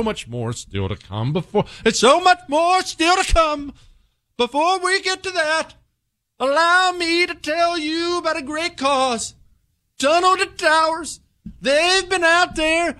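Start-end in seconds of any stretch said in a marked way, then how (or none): 1.8-3.7 high, booming mock radio announcer; pompous announcer voice. Shown as a demonstration. Host hamming up the ad lead-in in an announcer voice. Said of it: "how about we just speak like normal people?". 4.4-13.0 high, booming mock radio announcer; pompous announcer voice. Shown as a demonstration. Host hamming up the ad lead-in in an announcer voice. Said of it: "how about we just speak like normal people?".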